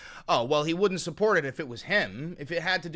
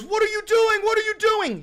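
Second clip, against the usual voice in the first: much higher, loud panicked yell; panicked father; 6 dB louder than usual. Retold or imitated. Father shouting at ICE agents, high panicked yelling. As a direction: scream it like a father watching his wife taken. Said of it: "Your father goes".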